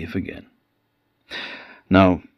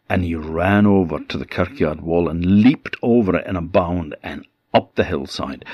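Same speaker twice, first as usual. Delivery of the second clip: ordinary delivery, nothing marked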